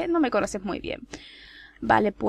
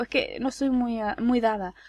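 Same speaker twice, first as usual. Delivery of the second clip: normal talking voice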